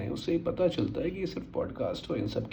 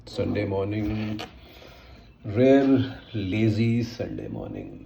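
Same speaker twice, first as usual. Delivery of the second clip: lazy; 6 dB louder, slower than usual. Acted. Greeting a lazy Sunday morning.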